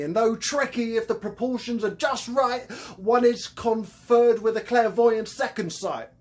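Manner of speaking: a grand Cockney hustler selling potions; Cockney con man. Acted. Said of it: "His thick Cockney accent".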